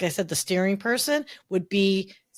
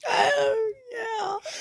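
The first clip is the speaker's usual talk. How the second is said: high, soft, between crying and laughing; tearful laughing; 14 semitones higher, 4 dB quieter than usual. Real, not acted. Moved by a memory from twenty-five years ago, tearful. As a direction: let it squeak out through happy tears. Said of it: "you just made me cry".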